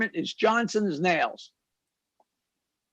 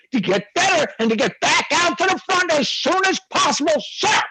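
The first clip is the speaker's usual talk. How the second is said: eager soldier; 8 semitones higher than usual. Acted. Soldier answers officer eagerly.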